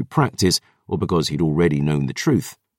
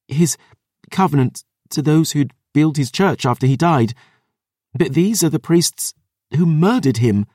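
put-on lightly higher girl's voice, shaking; young woman voice; 8 semitones higher than usual. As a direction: voice Dior, trembling with feeling. Dior explains, voice shaking.

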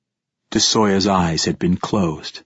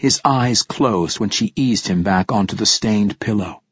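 ordinary delivery

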